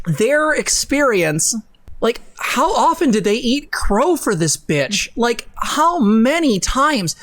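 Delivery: high, slow incredulous rant; slow outrage. Real, not acted. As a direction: drag it out in disbelief.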